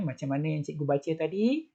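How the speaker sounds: ordinary delivery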